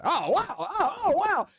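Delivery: high, rising oohs and wows with a laugh; a thrilled crowd gasping at the act; wowed crowd. Acted. Game master voicing the crowd, wowed and laughing.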